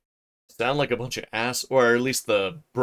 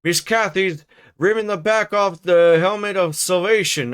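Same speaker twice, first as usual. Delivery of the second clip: put-on higher, louder call in a broad rustic accent; shouting old servant; 6 semitones higher, 3 dB louder than usual. Joseph calls to the master in a high rustic shout. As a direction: call the master across the house, outraged. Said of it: "shouted our chaplain".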